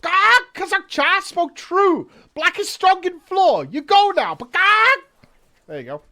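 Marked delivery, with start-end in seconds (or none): 0.0-5.0 very high, loud squawky bird voice; bird creature voice. Acted. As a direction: screech it like a bird-man. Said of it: "Go back to the old voice".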